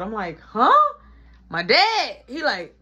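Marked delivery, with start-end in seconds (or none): 0.4-0.9 high, loud confused yelp; startled huh. Retold or imitated. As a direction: jump up in disbelief. Kid confused by dad.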